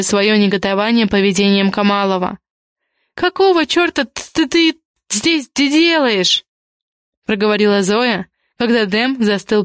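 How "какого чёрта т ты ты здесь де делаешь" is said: high-pitched, stuttering indignation; indignant stammer. A narrator's reading. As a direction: demand answers, tripping over the words in outrage. Indignant Zoya confronts Dem, stammering.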